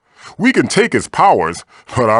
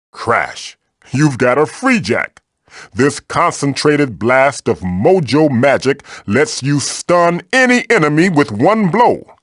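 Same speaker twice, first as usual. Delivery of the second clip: ordinary delivery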